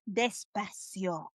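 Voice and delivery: soft, low, drawn-out pronunciation; hushed half-speed demonstration; slow word model. Shown as a demonstration. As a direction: stretch the word out gently for the learner. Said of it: "That's what you're gonna do".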